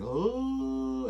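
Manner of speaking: sudden high yelp of surprise; mock startled yelp before dying. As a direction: jump at the bright lights.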